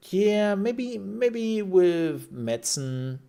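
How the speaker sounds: high, stretched-out musing, gradually sliding down; drawn-out maybe. Retold or imitated. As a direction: start high and loud, drag it out tentatively. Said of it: "I'm just like".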